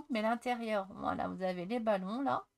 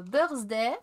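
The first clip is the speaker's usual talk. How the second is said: English words said with a thick French accent; jokey Frenchified English; 5 dB louder, slower than usual. Shown as a demonstration. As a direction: say "happy birthday" the French way, no h. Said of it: "Vous avez vu un peu mon accent, euh, à la française".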